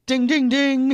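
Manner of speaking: high, loud ringing chant; imitated round bell. Co-host opening the second topic of the evening, imitated bell. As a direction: ring it out like a fight bell.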